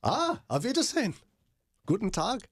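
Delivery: high, hammed-up German accent. Host showing off German phrases to co-hosts, hammed-up accent. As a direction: ham up the German greetings. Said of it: "The Germans struggle with the T H sound".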